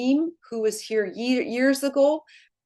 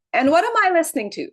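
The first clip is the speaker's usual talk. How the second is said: quick, much higher, louder mock confusion; mock bewilderment; 8 semitones higher, 5 dB louder, much faster than usual. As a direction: rattle it off like you're baffled. Retells her reaction to Spanish messages.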